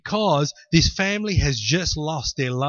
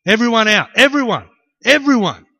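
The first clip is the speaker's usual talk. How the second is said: high, loud repeated command; shouted order; 5 semitones higher, 9 dB louder than usual. Acted. Jesus clearing the house, loud insistent order. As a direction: drive the whole room out, louder each time.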